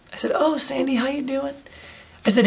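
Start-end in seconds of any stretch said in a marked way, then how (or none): 0.3-1.5 high, soft, friendly hello; lightly acted high-pitched greeting to a waitress. Deacon greeting his waitress, high and soft.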